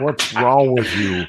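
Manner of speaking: slow, scornful mock question; mocking disbelief. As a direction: drag it out like a doubter. Mocking the caller's challenge.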